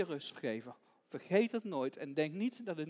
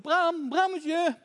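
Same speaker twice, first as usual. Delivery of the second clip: high, loud drawn-out call; 10 semitones higher, 10 dB louder, much slower than usual. Acted. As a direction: call the lost lamb with long vowels. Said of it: "En ze roept".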